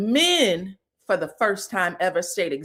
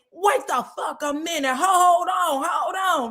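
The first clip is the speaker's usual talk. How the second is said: screaming; 7 semitones higher than usual. Retold or imitated. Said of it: "Now she's screaming".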